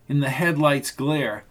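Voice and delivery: ordinary delivery, nothing marked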